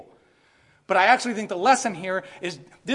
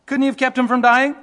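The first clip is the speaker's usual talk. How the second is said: louder mocking question; crowd's jeering question; 5 semitones higher, 8 dB louder than usual. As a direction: throw it out as a loud challenge. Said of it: "are saying".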